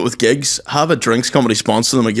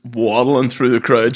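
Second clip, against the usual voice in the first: ordinary delivery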